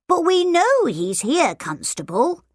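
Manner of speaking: butter up the constable; sweet and polite. A narrator's reading. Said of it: "in his sweetest, most polite voice".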